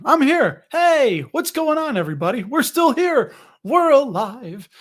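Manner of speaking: loud, high burst of relief; excited return. Real, not acted. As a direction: announce you're back, all pumped up. Coming back after the stream froze.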